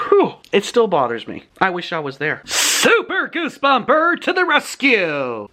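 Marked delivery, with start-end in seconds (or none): 2.5-5.5 high, stretched-out heroic call; hammed-up rallying cry; mock superhero cry. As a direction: play a superhero swooping in. Announces self as rescuing superhero.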